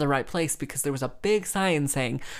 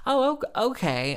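sudden high startled okay; retold startled oh okay; surprised; 10 semitones higher than usual. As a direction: caught off guard, voice jumps up. Caught off guard by the news.

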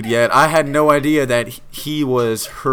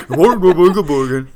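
a lively mock Swedish Chef babble, pitched up and louder; playful Swedish Chef nonsense; Swedish Chef impression; 6 semitones higher, 7 dB louder, slower than usual. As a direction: ham up a muppet cook's gibberish. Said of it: "Swedish chef or something".

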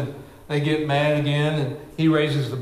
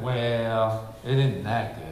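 low, softer begrudging dismissal; grudging attitude; 6 semitones lower, 5 dB quieter, slower than usual. Retold or imitated. Someone shrugging off a blessing, low and grudging. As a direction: shrug off the good news grudgingly. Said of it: "that kind of attitude".

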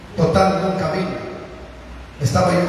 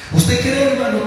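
challenging; 6 semitones higher than usual. Real, not acted. Preacher challenging the listener.

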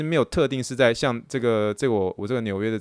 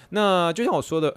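voice cracking; 6 semitones higher than usual. Real, not acted. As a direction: voice cracks mid-phrase. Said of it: "突然破音".

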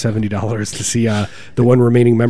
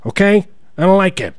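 high-pitched mock politician; Trump; 8 semitones higher than usual. Comic airing his dislike, high-pitched Trump impersonation. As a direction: complain in a raised presidential impression. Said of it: "That's my Trump impersonation".

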